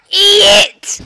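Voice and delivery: furious screamed insult; angry shout. Real, not acted. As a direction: yell it furiously. Angry gamer yelling at someone.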